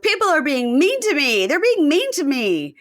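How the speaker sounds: loud, high mock tantrum; Mahmoud's meltdown. Man complaining everyone is mean to him, mock meltdown. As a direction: wail it in exaggerated outrage. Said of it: "a total meltdown".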